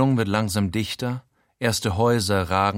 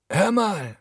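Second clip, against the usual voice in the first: higher pleading voice of a pushy con man; wheedle, pitched up; Frankie's voice; 10 semitones higher than usual. A narrator's reading. Frankie leaning in on his friend, pleading.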